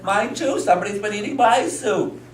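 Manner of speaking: high-pitched storybook mother's complaint; mother in story. Acted. Mother joins father over eaten soup, high mock indignation. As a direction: chime in with hammed-up indignation. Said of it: "And the mother said".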